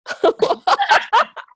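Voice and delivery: high, giggly burst of laughter. Real, not acted. Group laughing at her.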